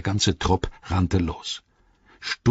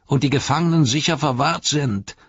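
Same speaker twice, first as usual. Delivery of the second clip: high-pitched orc voice; defiant high-voiced orc; Grishnach; 7 semitones higher, 3 dB louder than usual. Grishnach answers his rival Ugluk.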